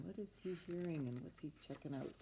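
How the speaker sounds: usual speaking voice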